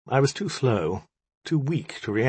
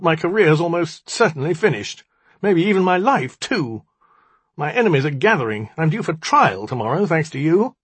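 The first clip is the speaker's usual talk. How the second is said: higher, aggrieved outburst; heated cry; 6 semitones higher, 4 dB louder than usual. A narrator's reading. Magician blames the djinni for his ruin, crying out. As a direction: pour out the grievance, pitched up. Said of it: "he cried".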